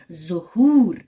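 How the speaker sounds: ज़हूर with a loud, long open oo; teacher stressing the open oo in ज़हूर; open waw demo. Shown as a demonstration. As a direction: announce ज़हूर with a full clear oo. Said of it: "गो इसको हम वाव मारूफ कहते हैं".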